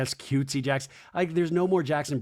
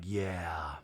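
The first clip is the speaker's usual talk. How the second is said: low, soft fading to a whisper; mock Schwartz dropping to a whisper; imitating Schwartz; 9 semitones lower, 11 dB quieter than usual. Recapping the show, mimicking Schwartz with a low, whispery yeah. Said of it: "And Schwartz is like".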